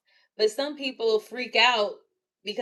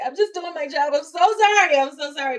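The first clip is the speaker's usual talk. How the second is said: high, anxious over-apologizing; pleading sorry to the QA reviewer, rising high; frantic apology; 7 semitones higher, 7 dB louder than usual. Retold or imitated. Reviewed worker pleading to QA, high and frantic.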